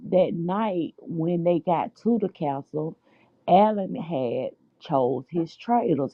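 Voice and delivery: ordinary delivery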